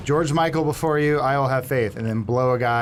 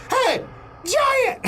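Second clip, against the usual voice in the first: much higher cocky shout-out; taunting call. Retold or imitated. David brashly calls out to the giant. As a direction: call up to him like a brash kid.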